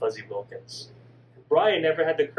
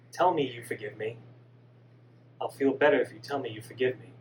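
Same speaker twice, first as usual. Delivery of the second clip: put-on lower, softer pleading boy; gentle dead son; 6 semitones lower, 3 dB quieter than usual. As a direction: hush her tears softly. Son's apology voice.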